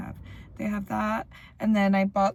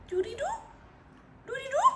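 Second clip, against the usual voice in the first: high sing-song cooing to a pet; much faster than usual. Real, not acted. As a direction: coo it up high.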